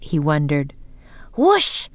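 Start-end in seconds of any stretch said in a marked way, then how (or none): 1.3-1.9 high, loud wind sound, rising; playful loud wind noise; loud whoosh. A narrator's reading. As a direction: blow it out like a gust of wind. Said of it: "said Annie loudly".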